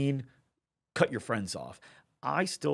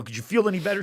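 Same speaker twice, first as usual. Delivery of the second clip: much higher and louder, giddy; excited question; 9 semitones higher, 7 dB louder, faster than usual. Retold or imitated. Coach to friend imagining the win, high and thrilled. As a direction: pump her up with an exhilarated ask. Asking about peak excitement.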